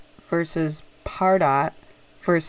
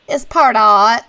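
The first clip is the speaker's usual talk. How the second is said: a put-on accent on It's Pardot, louder and higher; a badly faked accent, said for laughs; horrible mock accent; 5 semitones higher, 7 dB louder, slower than usual. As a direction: ham up a bad foreign accent on Pardot. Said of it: "that's a horrible not accent".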